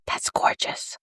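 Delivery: awed whisper; excited little whisper about the swatch. Real, not acted. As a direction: whisper it, thrilled by the product. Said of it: "Why am I whispering?".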